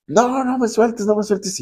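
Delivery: mock-panicked girl clinging on; high squeaky imitation of a pleading friend; retold frantic begging. Friend keeps begging after rescue.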